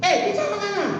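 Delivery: high-pitched imitation of an angry boss; retold angry demand. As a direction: act out the chief cutting in crossly. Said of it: "dia marah". (menirukan ketua marah dengan nada tinggi)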